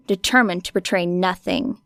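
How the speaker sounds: ordinary delivery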